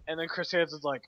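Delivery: normal talking voice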